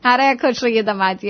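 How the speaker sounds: usual speaking voice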